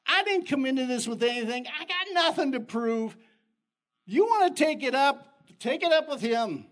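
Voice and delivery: high, loud dare; defiant declaration. Acted. Preacher defying his mockers, loud and bold. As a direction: challenge anyone to take it up.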